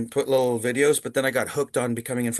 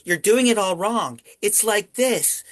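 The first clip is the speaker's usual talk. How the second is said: higher voice imitating a mock scolding; retold correction; 7 semitones higher, 3 dB louder, slower than usual. Co-star correcting the impression, higher imitated voice. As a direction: correct him emphatically, pitched up. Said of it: "He goes".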